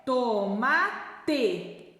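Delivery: tomate in slow separate syllables; sounded out slowly. Shown as a demonstration. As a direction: stretch out each syllable for children. Teacher sounds out word slowly. (sílabas bem separadas e lentas)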